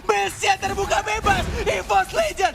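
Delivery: high, loud climactic call; esports caster. Real, not acted. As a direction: scream the finale like a caster. (teriakan komentator esports di akhir laga)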